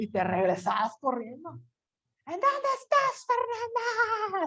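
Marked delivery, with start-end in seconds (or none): 2.3-4.5 very high, slow, drawn-out yell; a mother calling out a threat in a quivering voice; trembling mother's call. Acted. Mother calling for her missing daughter, trembling and high. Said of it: "Hasta la voz temblorosa".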